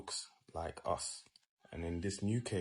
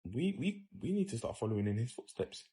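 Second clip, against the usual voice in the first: excited realisation; 6 semitones higher than usual. Retold or imitated. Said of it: "wake up and say".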